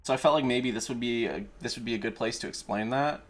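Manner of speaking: usual speaking voice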